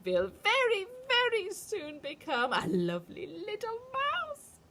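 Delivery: loud, slow witch chant, climbing high; shouting witch. A narrator's reading. Witch gloating over Bruno, slow and loud. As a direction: spit out the insults with glee. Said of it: "and then she shouted".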